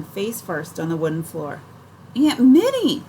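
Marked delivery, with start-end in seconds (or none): 2.2-3.0 loud, high cry of alarm. A narrator's reading. Girl crying out as her aunt faints, loud high alarm. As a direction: call out as she drops to the floor. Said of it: "I cry".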